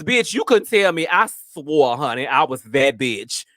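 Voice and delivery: ordinary delivery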